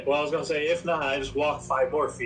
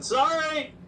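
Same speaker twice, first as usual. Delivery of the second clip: yelled apology; 11 semitones higher than usual. Acted. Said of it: "I yell out in Goblin".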